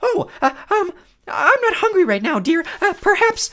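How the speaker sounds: very high, loud fake lady voice; nervous falsetto bride. Grover disguised as the bride stalling the Cyclops, falsetto. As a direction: squeak it out like a frightened bride.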